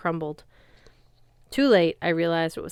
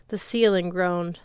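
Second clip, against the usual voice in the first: ordinary delivery, nothing marked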